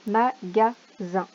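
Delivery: slow careful reading. Shown as a demonstration. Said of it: "word to sort by sound".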